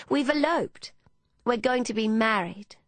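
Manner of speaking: put-on high, excited seventeen-year-old; unchecked enthusiasm. A narrator's reading. Emmeline announcing elopement to Hannah, unchecked enthusiasm. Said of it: "the unchecked enthusiasm of a seventeen-year-old".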